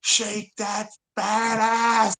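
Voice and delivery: drawn-out, high-pitched mock holler; Jerv yelling. Friend heckling a girl on the walk home, mock yelling. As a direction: imitate a catcall, stretching every word without real volume. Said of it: "yelling at that girl".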